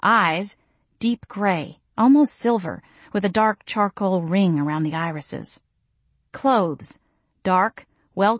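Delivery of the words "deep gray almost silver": plain reading voice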